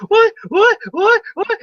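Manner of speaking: squeaky high "What?" repeated; high mock Mr Burns squeal; Mr Burns impression. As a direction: yelp like a shaken old man. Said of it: "Burns is going the whole time".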